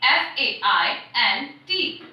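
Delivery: letters F A I N T spelled slowly, one at a time; spelling out letters. Shown as a demonstration. Teacher spelling a vocabulary word for students, slow letters. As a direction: spell FAINT for the class. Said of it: "faint means which is not clear".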